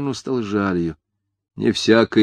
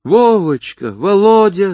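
high, loud, anguished call; crying out; 12 semitones higher, 6 dB louder, slower than usual. A narrator's reading. Mother seeing her arrested son, crying out to him. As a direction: call the name out in a high wail. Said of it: "закричала Чепурнова".

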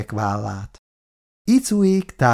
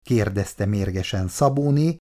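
usual speaking voice

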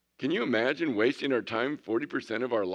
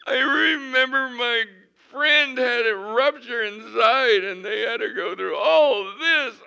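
high, loud mock panic with fake wailing; hammed-up panic; 12 semitones higher, 8 dB louder than usual. Preacher mocks a worrier imagining appendicitis, hammed-up panic. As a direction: ham up a hypochondriac's terror. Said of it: "our minds run wild".

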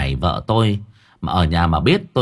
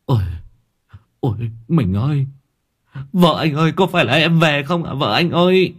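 mock weeping for a dead wife, lightly done; overacted widower's lament, half-hearted sobs; hammed-up fake wailing; 4 semitones higher than usual. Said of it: "phụ họa diễn cùng với cô khóc lóc".

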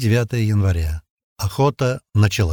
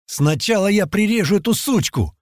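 higher-pitched, impulsive threat; sudden outburst; 10 semitones higher than usual. Acted. Hothead threatening a captive girl in front of everyone, blurted out. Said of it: "внезапно выпалил парень Архи".

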